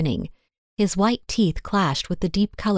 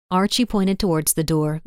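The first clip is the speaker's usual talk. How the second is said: usual speaking voice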